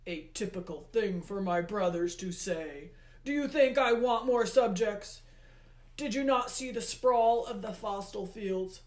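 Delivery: loud, forceful god's rebuke; Hades, exasperated. A narrator's reading. Hades complains of too many subjects, exasperated. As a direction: rant at full voice, affronted.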